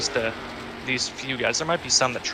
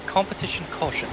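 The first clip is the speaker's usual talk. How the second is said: put-on competition caution in a posh British accent, pitched up; 7 semitones higher than usual. Co-host asks for a British accent, he obliges. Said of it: "in a British accent".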